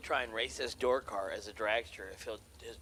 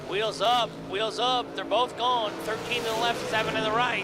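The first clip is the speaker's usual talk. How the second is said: higher, louder burst as the cars launch; excited race call; 9 semitones higher, 12 dB louder than usual. Acted. Launch called live.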